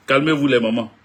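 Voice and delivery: laughing while telling the mamans to calm down. Real, not acted. Streamer laughing as he tells worried mothers to calm down. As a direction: chuckle through the words, amused.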